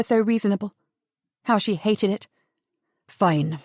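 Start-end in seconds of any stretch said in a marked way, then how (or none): 3.1-3.6 much lower, giving up; defeated. Acted. Adam giving up on Sarah, low and defeated. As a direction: let the fight drain out. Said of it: "in a defeated voice".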